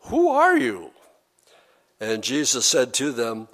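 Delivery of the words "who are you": high, loud acted challenge; indignant outburst. Listeners demand Jesus explain himself, acted indignant outburst. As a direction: throw it out at a much higher pitch, loudly.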